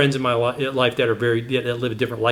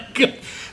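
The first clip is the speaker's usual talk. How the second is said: breathy laugh. Real, not acted. Laughing at the story.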